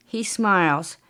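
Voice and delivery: usual speaking voice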